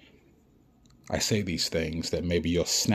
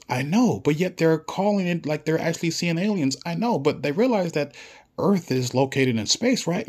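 much higher, louder, mocking official voice; squeaky mock official; 10 semitones higher, 4 dB louder than usual. Vlogger mimics officials laughing at the public, high squeaky mock voice. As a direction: squeak it out like a silly gossiping bureaucrat.

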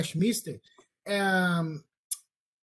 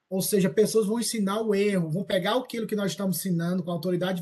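ordinary delivery

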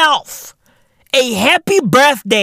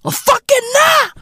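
high shout; 13 semitones higher than usual. Real, not acted. Repeated question shouted.

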